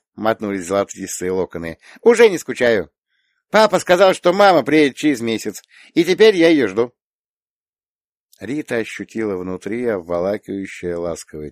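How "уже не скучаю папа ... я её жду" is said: put-on higher, louder child's voice; small girl explaining with conviction; earnest little girl. A narrator's reading. Little girl tells aunt about mama's return, earnest.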